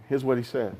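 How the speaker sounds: ordinary talk, nothing marked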